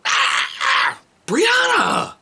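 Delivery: high loud shout of her name; Brianna! yelled high and loud; exasperated yell. Retold or imitated. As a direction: bark her name to make her stop. Yelling at daughter again.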